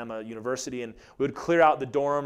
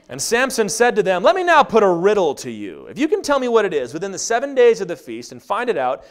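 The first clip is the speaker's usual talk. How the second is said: scripture reading; 8 semitones higher, 12 dB louder than usual. Acted. Reads scripture.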